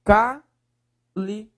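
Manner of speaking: cálice broken into ca, li, ce with clear gaps; syllable by syllable. Shown as a demonstration. Teacher separating the word on request, syllable by syllable. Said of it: "Separe, por favor".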